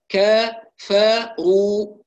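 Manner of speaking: كفروا very slow, whispered clear fa, heavy ra; model recitation. Shown as a demonstration. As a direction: recite slowly and cleanly. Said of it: "أقول فا".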